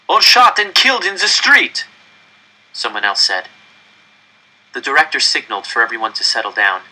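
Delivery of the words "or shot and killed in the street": high, loud cry from the crowd; shouted protest. Acted. Another crowd member yelling back at the director. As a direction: throw it at the stage.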